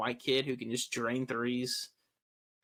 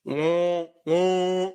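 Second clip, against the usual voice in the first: silly clown horn noise; 4 semitones higher, 5 dB louder than usual. Pretend. Yeah, do the noise.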